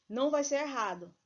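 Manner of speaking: ordinary talk, nothing marked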